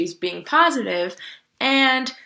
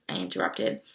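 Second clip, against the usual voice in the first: usual speaking voice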